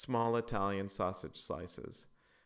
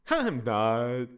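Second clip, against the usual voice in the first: put-on loud high kid bellow; child bellowing; 5 semitones higher, 11 dB louder than usual. A narrator's reading. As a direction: belt it out like a defiant little girl. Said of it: "Loretta bellowed".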